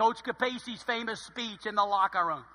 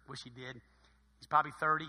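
ordinary talk

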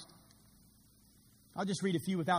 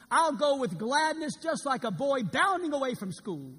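higher, louder glad voice; joyful; 8 semitones higher, 6 dB louder than usual. Acted. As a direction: bound away gladly like a boy. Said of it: "Missionary Adoniram Judson".

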